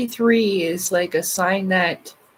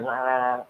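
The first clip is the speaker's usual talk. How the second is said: a low croak, like a frog stuck in the throat; mock throat croak; croaky frog-in-throat rasp; 7 semitones lower, 4 dB quieter than usual. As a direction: imitate the croak that came out when her voice went weird. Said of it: "swallow like a frog in the throat".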